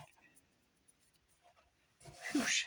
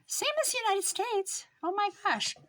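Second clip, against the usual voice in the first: much higher, excited outburst; excited exclamation; 13 semitones higher than usual. Real, not acted. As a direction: squeal it out in disbelief. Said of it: "You can't understand 'em".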